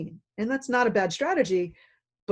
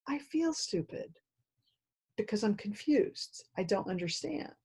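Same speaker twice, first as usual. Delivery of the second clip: dejected admission; 3 semitones higher, 8 dB quieter than usual. Retold or imitated. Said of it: "what they really mean is".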